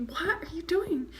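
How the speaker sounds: puzzled whisper. Retold or imitated. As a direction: ask it under your breath, baffled. Officer's bewildered quote, whispered.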